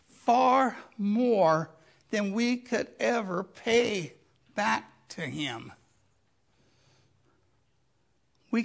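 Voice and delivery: rising preaching. Acted. Preacher urging the congregation, full-force preaching. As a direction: drive the point home to the congregation.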